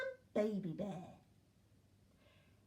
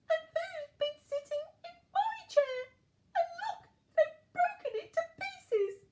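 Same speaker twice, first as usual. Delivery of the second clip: very high squeaky little bear, upset; tiny Baby Bear; 5 dB louder than usual. A narrator's reading. Baby Bear finds his chair broken, tiny upset squeak. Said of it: "said in a tiny voice".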